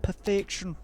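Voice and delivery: a hammed-up Aussie savouring success; mock Australian accent. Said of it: "my Australian accent is offensive".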